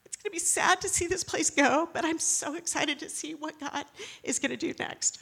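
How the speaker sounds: holding back tears; teary. Real, not acted. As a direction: voice breaking with tears. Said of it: "I'm getting teary about that".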